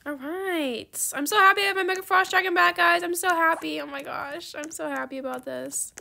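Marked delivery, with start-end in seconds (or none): 1.1-3.8 high, loud and fast with joy; gleeful. Real, not acted. Gamer getting her dragon back, gleeful. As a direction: gush with delight.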